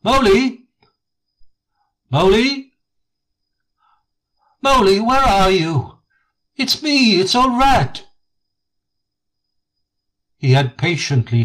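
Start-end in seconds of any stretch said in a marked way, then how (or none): 0.0-8.1 high, bright calls across the woods; calling out cheerfully. A narrator's reading. Rat searching the wild wood for Mole, cheerful high calls. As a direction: sing out the name to a lost friend. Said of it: "calling out cheerfully".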